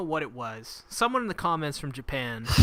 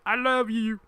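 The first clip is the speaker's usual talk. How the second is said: higher, louder put-on lover's voice; lightly hammed-up confession, pitched up; mock love declaration; 8 semitones higher, 6 dB louder than usual. Dude confessing to the girl, mock declaration.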